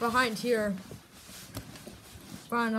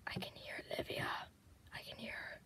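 whispering while hiding; 20 dB quieter, faster than usual. Real, not acted. Hiding, the seeker is near.

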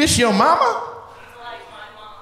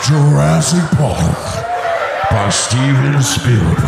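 slow, deep-ish dramatic announcer; movie trailer voice; 6 semitones lower, slower than usual. Pretend. Comic announcing Jurassic Park, slow dramatic trailer voice. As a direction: intone it like a trailer. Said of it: "that scene in Jurassic Park".

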